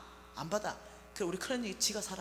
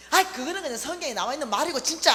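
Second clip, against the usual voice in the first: louder, higher irritated retort; irritated outburst; 5 semitones higher, 10 dB louder, faster than usual. Retold or imitated. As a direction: brush off your mother angrily. Daughter snapping at mother.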